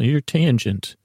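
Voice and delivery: ordinary talk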